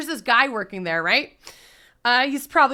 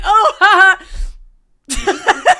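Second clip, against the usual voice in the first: high, loud nervous laughing oh ha ha; flustered giggling; awkward laugh; 7 semitones higher, 10 dB louder, much slower than usual. Retold or imitated.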